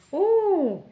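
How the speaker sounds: high, loud "oh" of surprise; delighted surprise. Real, not acted. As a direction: let out a loud, high ooh. Discovers bookmark in unboxing. (« oh » de surprise, aigu et fort)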